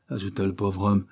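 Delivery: ordinary delivery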